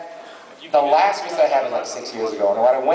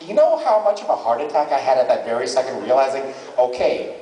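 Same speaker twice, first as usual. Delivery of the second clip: ordinary talk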